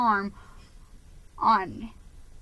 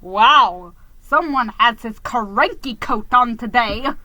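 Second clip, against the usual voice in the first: snarky jab; 8 dB louder than usual. A narrator's reading. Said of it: "he jerked".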